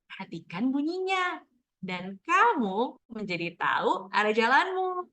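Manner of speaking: slow, lilting storybook ending; reading to children. Shown as a demonstration. Teacher finishing a picture book read-aloud, slow and expressive. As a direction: read it out with big pitch swoops.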